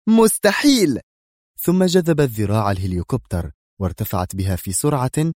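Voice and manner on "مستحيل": high, loud refusal; defiant cry. A narrator's reading. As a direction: shout it back defiantly. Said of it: "هاتفة". (هتاف رافض حاد)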